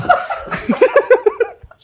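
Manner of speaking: high loud burst of laughter; real laughter; big laugh. Real, not acted. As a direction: howl at the memory. Laughing at mum story.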